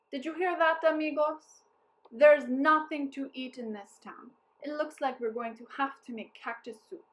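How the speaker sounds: a hint of a showman captain; booming captain. A narrator's reading. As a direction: announce it to the troops. Captain addresses his men.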